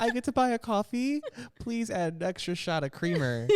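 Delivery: high-pitched giddy delight; hammed-up excitement, voice jumping up high; playfully excited. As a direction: gush over a little treat. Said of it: "he gets so excited".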